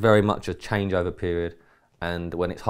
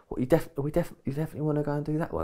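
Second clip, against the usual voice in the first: higher, fast, doubting mock-Jim voice; imitating Jim's doubt; 8 semitones higher, 6 dB quieter, faster than usual. Jim doubting the plan on the drive there, mock incredulous. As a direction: toss it off quick and high, half disbelieving. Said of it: "he's like".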